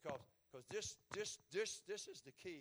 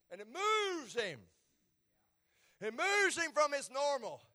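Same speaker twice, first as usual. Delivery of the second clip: rising to a loud, high preaching peak; pushing the congregation toward the big point; building sermon; 7 semitones higher, 14 dB louder than usual. Acted. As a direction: climb and let it ring out. Climbing sermon.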